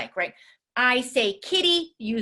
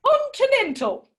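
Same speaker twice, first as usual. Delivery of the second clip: very high, loud, stretched cartoon voice; over-the-top squeaky character voice; biggest silly voice; 14 semitones higher, 6 dB louder than usual. Shown as a demonstration. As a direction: go all out on the third take. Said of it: "by the third time ... bigger".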